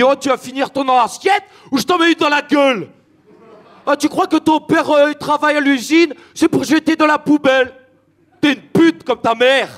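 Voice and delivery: put-on angry parent in a Picard ch'ti accent. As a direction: blow up at the daughter in a thick ch'ti accent. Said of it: "quand je m'énerve, je prends l'accent ch'ti".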